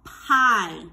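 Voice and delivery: PIE stretched out loud and clear; PIE, drawn out with a long vowel; model word. Shown as a demonstration. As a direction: sound out PIE slowly for pupils to copy. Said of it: "So my turn, your turn".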